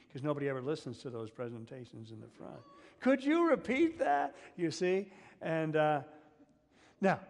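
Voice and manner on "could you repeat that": high, loud, slow bewildered passenger asking; panicked passenger. Acted. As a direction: play a flustered flyer who missed the safety talk. Panicking passenger not listening.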